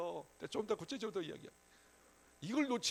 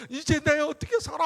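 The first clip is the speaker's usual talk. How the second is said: loud hammed-up lament, climbing high at the end; mock ranting complaint; 6 semitones higher, 11 dB louder than usual. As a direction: blast it out like a griping whiner. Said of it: "막 퍼붓기는 잘했는데".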